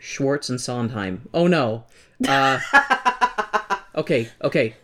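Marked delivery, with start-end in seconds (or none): none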